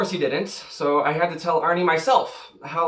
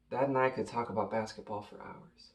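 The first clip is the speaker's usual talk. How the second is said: soft, low, subdued remembering; wistful grief; 6 semitones lower, 12 dB quieter than usual. Acted. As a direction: let the voice drop, missing him. Grieving son remembers his late father.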